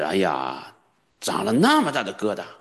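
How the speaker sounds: ordinary talk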